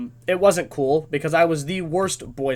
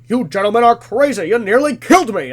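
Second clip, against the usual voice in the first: high, loud mock outrage; indignant kid; 5 semitones higher, 8 dB louder than usual. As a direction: imitate an offended kid telling them off. Rescued kid berates the boys.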